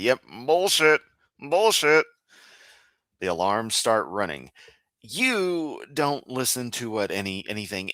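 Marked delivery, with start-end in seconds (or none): 0.0-2.0 louder, higher dismissive call; mock alarm. A skeptic checking Kent's claims, loud mock verdict. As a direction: bark it like a fact-check alarm. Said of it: "we check things against actual facts and find".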